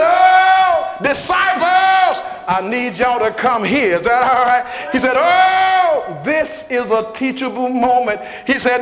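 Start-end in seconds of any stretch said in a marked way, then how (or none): 0.0-4.0 sung high whoop, loud; preaching whoop. Acted. Preacher whooping to the congregation about giving. Jesus calling disciples, whooped. 5.2-8.2 preaching whoop. Acted. Preacher whooping to the congregation about giving.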